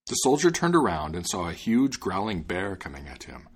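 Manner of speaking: ordinary delivery, nothing marked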